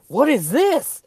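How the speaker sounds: high, loud baffled voice; mock bewildered city folk. Mocking city people seeing a cow, high and baffled. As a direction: play the clueless gawker. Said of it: "they're just like".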